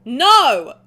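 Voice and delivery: loud flat refusal, yelled; defiant shout. Acted. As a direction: refuse him with a full-throated yell. Heroine refuses to release him.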